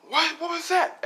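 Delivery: very high, loud alarm; startled cry. Acted. Bystander hearing the blast, loud startled question. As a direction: shoot the pitch up in alarm. Reaction to the explosion.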